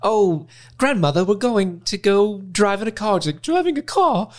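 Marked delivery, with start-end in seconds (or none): 0.0-3.2 high, prim upper-class English; posh British voice. Retold or imitated. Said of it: "upper class British isms". 3.4-4.3 high, scandalized upper-class matriarch; stuffy old British lady. Retold or imitated. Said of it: "upper class British isms".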